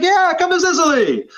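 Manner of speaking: high, eager Sicilian host inviting; a friend's enthusiastic yes; eager invitation. Retold or imitated. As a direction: sell the trip, bright and keen. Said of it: "He's like".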